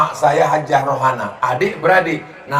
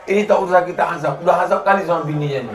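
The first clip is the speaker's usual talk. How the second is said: fast; much faster than usual. Acted. Quoting angel, joke punchline.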